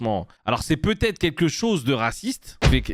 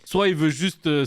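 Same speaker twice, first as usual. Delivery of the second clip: ordinary talk, nothing marked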